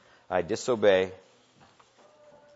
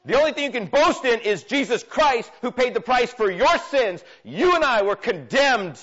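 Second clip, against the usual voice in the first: impassioned preacher, rising and pressing; preaching at full force; 5 semitones higher, 4 dB louder than usual. Acted. Pastor urging the congregation, fervent preaching. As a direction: drive the sermon home to the church.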